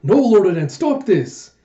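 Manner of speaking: high, loud retold cry to stop; urgent protest. Vassal begs the lord holding him up to stop, urgent protest. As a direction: plead loudly, pitch jumping up.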